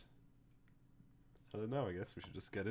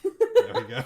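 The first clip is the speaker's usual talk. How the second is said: laughing; 16 dB louder than usual. Real, not acted. Relieved after a long delay.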